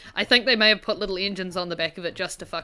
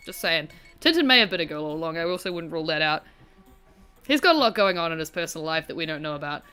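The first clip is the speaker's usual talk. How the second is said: ordinary talk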